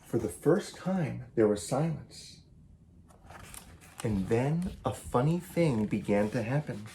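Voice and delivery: lively picture-book read-aloud, each animal line acted; story reading. A narrator's reading. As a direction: read it to children with bounce and play. Author reads picture book aloud.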